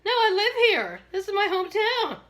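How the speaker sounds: emphatic. Retold or imitated. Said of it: "I said".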